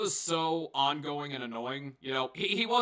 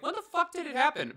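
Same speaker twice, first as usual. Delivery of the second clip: incredulous; 12 semitones higher than usual. Retold or imitated. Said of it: "I'm like".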